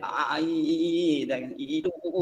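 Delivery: lightly shown stammer, repeated vowels; stutter demo. Shown as a demonstration. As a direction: demonstrate a halting stutter softly. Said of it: "gitu ya, seperti itu".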